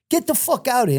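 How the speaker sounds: high-pitched imitated command; retold order. Lieutenant ordering prisoners out of the van, high retold command.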